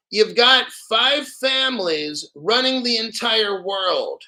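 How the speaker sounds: slow, emphatic explanation for the dim-witted; slow, spelled out. Real, not acted. Streamer explains who runs the world to slow viewers, slow and condescending. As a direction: lay it out slowly, word by word. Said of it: "I'll say it slow".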